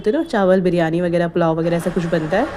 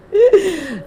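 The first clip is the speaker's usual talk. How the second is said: a squealing laugh, much higher; high burst of laughter; 12 semitones higher, 3 dB louder than usual. Real, not acted. Vlogger laughing at her childish screaming on the ride. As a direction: break into a high giggle.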